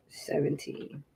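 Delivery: seventeen whispered under the breath. Real, not acted. Setting the sprint timer.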